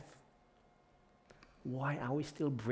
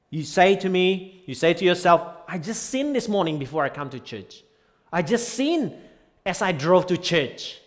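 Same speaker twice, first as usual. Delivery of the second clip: high, loud whiny confession; voiced guilty churchgoer; 8 semitones higher, 12 dB louder than usual. Preacher acting a churchgoer who sinned on the drive.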